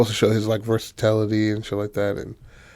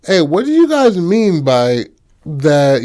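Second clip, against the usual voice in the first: raised, high challenging question; confronting them; 6 semitones higher, 5 dB louder than usual. Retold or imitated. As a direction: demand an explanation, loud and high. She confronts the group.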